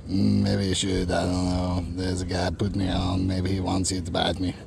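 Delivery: a hint of a bait sharing a secret, murmured; quiet bait voice. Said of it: "Then the bait says".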